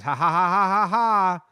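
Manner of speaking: loud, gloating fake laugh; mocking laugh. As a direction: cackle in his face like a bully. Player taunting the hurt opponent.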